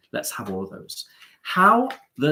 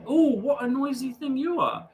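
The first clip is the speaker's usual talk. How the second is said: very high, cooing reproach; playful mock scolding; 13 semitones higher than usual. Host to someone beeping outside, playful high-pitched scolding. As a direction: chide it lightly way up high.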